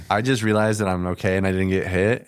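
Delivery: ordinary delivery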